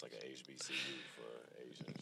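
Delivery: slow, whispered joke. Real, not acted. Joking aside to cohost.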